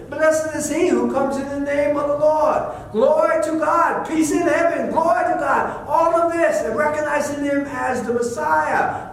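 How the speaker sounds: high, loud, impassioned sermon cry; preaching at full force. Acted. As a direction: preach it to the rafters.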